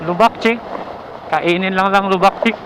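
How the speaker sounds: on a bumpy road. Real, not acted. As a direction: voice jolted by bumps. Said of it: "Lubak, oh". (boses na umaalog sa lubak)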